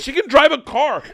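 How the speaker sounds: ordinary talk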